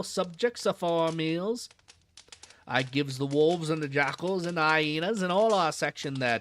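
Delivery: put-on old London zookeeper in a Cockney accent. Zookeeper chatting to a visitor, Cockney accent. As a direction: drop the aitches, Cockney keeper at ease. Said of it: "This guy is such an accent".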